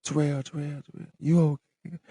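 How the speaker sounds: high, far-off voice that echoes as if down a tunnel; echoing. Retold or imitated. Nurse's voice echoing to a fading patient, high and far off. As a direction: call his name like a distant echo. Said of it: "it was like she was echoing".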